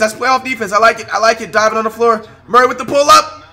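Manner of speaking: real hype; hyped up. Real, not acted. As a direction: cheer each play. Hyped live reaction.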